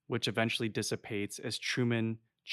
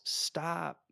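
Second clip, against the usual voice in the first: high mock yell without the volume; half-hearted imitation of a yell, pitched up; Meryl's yell; 7 semitones higher, 3 dB quieter than usual. Podcaster retelling Meryl in the speeding car, restrained mock yell. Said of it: "as Meryl yells".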